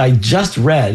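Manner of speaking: ordinary talk, nothing marked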